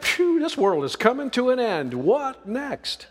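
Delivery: high, alarmed imagined exclamation; a touch of mock panic. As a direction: play the bystander gasping at the end of the world. Imagined reaction to disaster.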